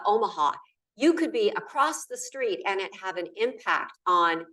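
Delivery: ordinary talk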